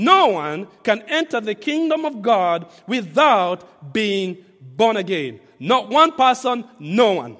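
slow, loud preaching insistence; emphatic preaching. Acted. Pastor insisting to the congregation, loud and slow. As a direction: press each word home to the congregation.

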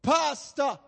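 high-pitched hail across the street; sudden high call, acting out a shout. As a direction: imitate a man shouting out for the pastor. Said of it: "he shouted out".

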